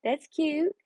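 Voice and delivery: high, airy cooing "That's cute"; high sing-song cooing over a follow-back. Retold or imitated. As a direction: coo it like a delighted fan. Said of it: "I would say".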